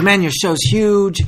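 slow, hammy fake Irish lilt; a deliberately awful Irish accent; bad Irish accent. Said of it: "It's the worst Irish accent ever".

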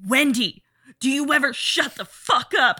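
hammed-up fed-up husband blowing up at his wife; mock angry outburst. Acting Jack snapping in car.

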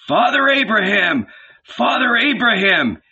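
high, pleading call from afar; crying out. A narrator's reading. Rich man in hell calls up to Abraham, crying out. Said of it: "he cried".

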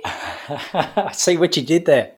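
slow, chuckling through the words; laughing. Real, not acted. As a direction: laugh while you answer. Laughing at a pun.

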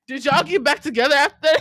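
loud, high, cracking up while asking; laughing hard. Real, not acted. Host asks co-hosts after a wild story, laughing. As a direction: shout it through real laughter.

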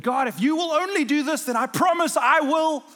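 bargaining with God, begging; loud, high mock pleading. Preacher imitating his own bargaining prayer.

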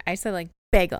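"bagel" with a hard, loud bay; stressed bay. Retold or imitated. Explaining her bagel to Brad, bay stressed loud. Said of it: "I emphasized that bay".

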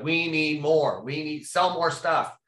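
hammed-up insistent manager; mock demanding boss. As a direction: drag out the demands like a pushy executive. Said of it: "it was like".

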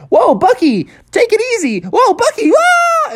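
very high rising alarm; alarmed monkey voice. Acted. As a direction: yelp it as the tree tips. George alarmed at falling tree.

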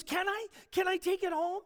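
high-pitched, eager retold plea; thrilled asking. Agnes asks Harry about the cake, thrilled and eager. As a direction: ask in delighted disbelief.

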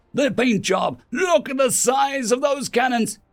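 high, shouted disbelief; heated, high-pitched scolding; incredulous outburst. A narrator's reading. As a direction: snap back in exasperated alarm. Incredulous retort in heated council quarrel.

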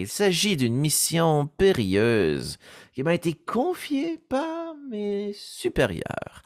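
dreamy, wandering voice of a lost seeker; floating voice. Shown as a demonstration. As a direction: drift through the question, unsure and searching. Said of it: "c'est une voix qui est flottante".

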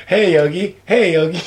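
tentative attempt at a cartoon sidekick; lightly voiced little bear, unsure of it; Boo Boo. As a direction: try out the sidekick's call. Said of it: "what does Boo Boo sound like".

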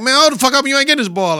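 mock Tatum. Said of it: "and Tatum like".

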